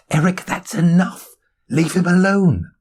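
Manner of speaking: sharp, high-pitched reprimand; scolding. Acted. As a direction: tell off an unruly pet. Scolding an over-friendly dog.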